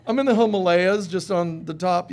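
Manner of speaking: smug caller. Retold or imitated. The ex calling from the Himalayas, loud breezy brag. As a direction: brag like a carefree traveler. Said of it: "they've got".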